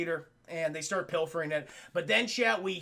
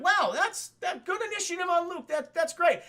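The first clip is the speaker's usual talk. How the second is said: high, chirpy mock uncle voice; pleased old uncle; 9 semitones higher, 3 dB louder than usual. Uncle praising the nephew's initiative, high and chirpy. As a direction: pitch it up like a proud uncle.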